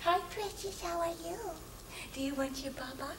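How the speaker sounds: put-on high cooing baby talk; brother's baby talk. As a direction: coo sweetly to the baby like a big brother. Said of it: "he goes".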